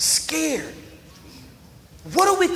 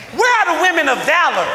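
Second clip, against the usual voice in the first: louder call-out; 4 dB louder, faster than usual. Acted. Preacher repeating his call to the women, fast and loud. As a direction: say it again and demand an answer. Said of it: "Wait, let me say that again".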